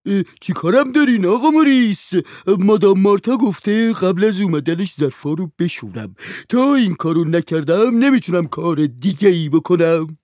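high, loud whining servant's voice. A narrator's reading. Servant Handsome answers his master, high and whining. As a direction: complain at being called on your day off.

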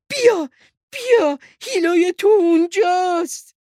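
loud, very high excited sheep voice; happy calling out. Acted. Happy sheep calls Hilo's mother over, loud excited call.

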